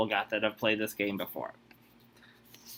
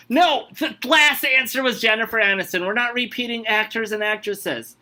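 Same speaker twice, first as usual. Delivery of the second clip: loud, higher exasperated yell; yelling correction; 7 semitones higher, 4 dB louder than usual. Real, not acted. Host yells at the guessing contestant, real loud exasperation. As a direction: shout the correction at the player. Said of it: "Don't have to yell at me".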